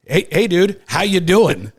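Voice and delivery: loud, high mock-casual hello; mock greeting. Golfer trauma dumping on a church friend, mock-cheery greeting. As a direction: toss out an over-eager greeting, mimicking yourself.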